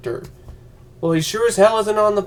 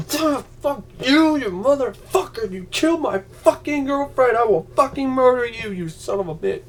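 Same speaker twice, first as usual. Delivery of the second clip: mock rage; 7 semitones higher than usual. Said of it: "Be like".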